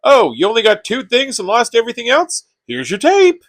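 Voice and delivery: high, put-on voice of a friend; mocking imitation voice. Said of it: "Maybe not in that voice".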